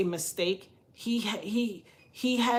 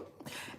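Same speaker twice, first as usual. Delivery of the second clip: laugh; 16 dB quieter than usual. Real, not acted. Laughing at the memory.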